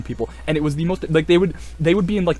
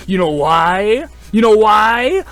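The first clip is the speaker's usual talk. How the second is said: loud rising question, higher the second time; teasing hype; 6 semitones higher, 7 dB louder, much slower than usual. Real, not acted. Asking viewers why Lancer wins, excited tease. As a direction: egg the audience on.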